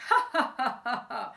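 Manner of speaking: hammed-up villain laugh; ha-ha it out like a crime boss; mock laugh. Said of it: "Mister Big started laughing".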